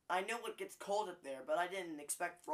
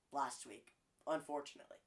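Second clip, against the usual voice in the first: ordinary talk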